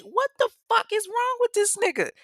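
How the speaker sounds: shocked outburst. Retold or imitated. Claudia reacting after Kevin rams her car, shocked and high. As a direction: yelp it out in disbelief.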